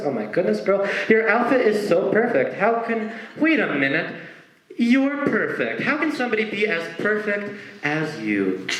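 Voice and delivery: gushing compliment. Acted. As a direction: pile on the flattery, swooning. Gushing over-the-top compliment.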